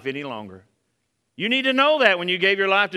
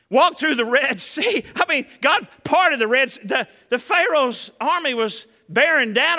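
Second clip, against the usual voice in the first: loud, ringing preacher's cry, a touch higher; preaching at full force; 5 semitones higher, 6 dB louder than usual. Acted. Pastor to congregation, thundering about the Red Sea, loud preaching.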